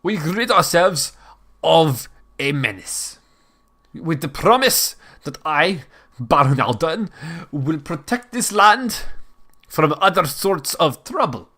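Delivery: put-on German-accented baron's proclamation; stagey German accent that drifts; wavering German accent. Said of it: "not the same German accent".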